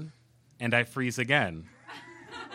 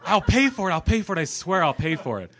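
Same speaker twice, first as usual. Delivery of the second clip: higher, slowed, pleading imitation of John; flustered apology; 9 semitones higher, 6 dB louder than usual. Director apologizing to storyteller for crashing his car, high pleading imitation. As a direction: babble sorry like a guilty New Yorker.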